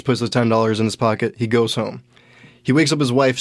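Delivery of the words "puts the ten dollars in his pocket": normal talking voice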